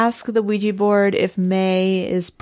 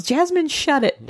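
mock yell; 11 semitones higher than usual. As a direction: imitate a yell without the volume. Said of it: "one of the women yells".

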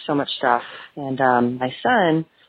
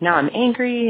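mock angry husband; 8 semitones higher than usual. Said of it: "And he's like".

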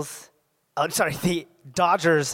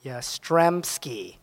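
drawn-out syllable by syllable; slow careful sounding out; 4 semitones lower, much slower than usual. Shown as a demonstration. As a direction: sound out each part slowly. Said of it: "Can you use it in a sentence?".

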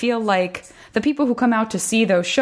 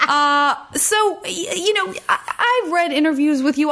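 put-on old woman voice; 10 semitones higher than usual. Carrying over the granny voice.

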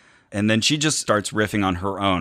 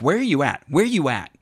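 self-questioning; 6 semitones higher, faster than usual. Retold or imitated. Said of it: "look at myself in the mirror and say".

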